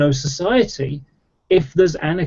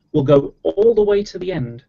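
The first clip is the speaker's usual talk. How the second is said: usual speaking voice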